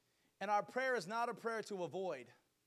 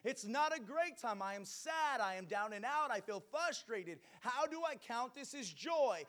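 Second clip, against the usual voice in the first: loud, high-pitched fiery preaching; booming motivational speech; 12 semitones higher, 10 dB louder than usual. Acted. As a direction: preach it out to the whole church. Preaching at full force.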